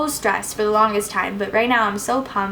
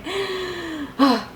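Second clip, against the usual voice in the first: emotional sigh; 9 semitones higher than usual. Real, not acted. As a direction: let out a sigh, moved. Moved after talking about her daughter.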